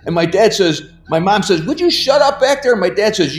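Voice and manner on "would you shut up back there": high-pitched mock irritated scolding; scolding mom. Mom to crying child in the back, imitated high scolding. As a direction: imitate an exasperated mother, pitched way up.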